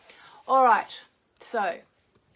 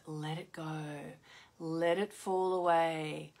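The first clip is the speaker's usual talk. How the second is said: releasing; 3 semitones lower, slower than usual. Shown as a demonstration. Host illustrating the waning moon's release, slow and soothing. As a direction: draw it out gently, like releasing something.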